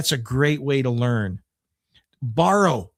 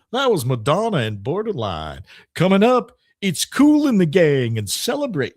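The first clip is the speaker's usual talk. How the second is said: mock radio DJ back-announce, swooping intonation; fake DJ voice. Shown as a demonstration. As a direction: announce the songs like a cheesy DJ, punching the next one. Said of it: "I'm using my fake D J voice".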